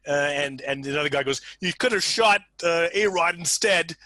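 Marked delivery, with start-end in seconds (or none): 1.6-4.0 raised, louder acted protest; mock complaint. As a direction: lightly play an exasperated colleague. Acted colleague complains in retold scene.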